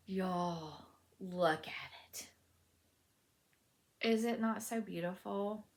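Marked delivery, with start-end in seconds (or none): 0.1-2.3 hushed, breathless whisper; awed whisper. Real, not acted. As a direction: whisper it in awe. Showing off finished shawl.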